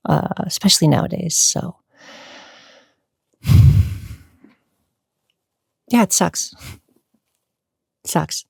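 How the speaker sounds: fighting back tears; real emotion, breaking off; choked up. Real, not acted.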